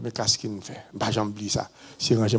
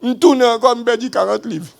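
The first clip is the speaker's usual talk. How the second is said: high and much louder; raised, astonished telling; exclaiming; 10 semitones higher, 9 dB louder than usual. Real, not acted. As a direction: cry out the shock of the weight lost. Telling of losing the weight.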